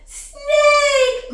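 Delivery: high, drawn-out alarm cry; hammed-up high-pitched shriek; retold scream. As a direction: act out screaming for help, stretching the word. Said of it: "I run out of the house screaming".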